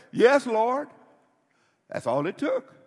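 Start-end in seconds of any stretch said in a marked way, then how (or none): 0.1-0.9 eager reply. Retold or imitated. Mimicking the blind men's eager reply.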